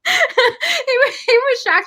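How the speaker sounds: words pushed out high through real laughter; laughing. Real, not acted. To the interviewer about her fiance, laughing. Laughing while retelling dad's reaction.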